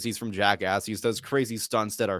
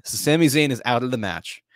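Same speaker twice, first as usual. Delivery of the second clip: normal talking voice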